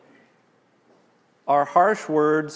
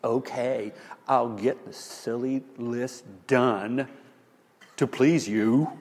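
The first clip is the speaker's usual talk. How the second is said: grudging, slow, resentful okay; with attitude; 4 semitones lower, 4 dB quieter, slower than usual. Retold or imitated. Husband grudgingly agreeing to please his wife, sullen. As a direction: give in, but make it sting. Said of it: "comply with attitude".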